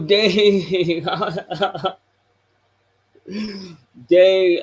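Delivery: ordinary talk, nothing marked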